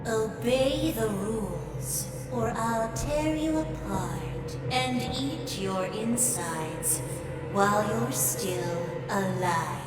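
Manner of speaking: chillingly quiet warning after the sweetness; sinister threat. Acted. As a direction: drop low and measured, threatening calmly. Playful host turns to deadly threat.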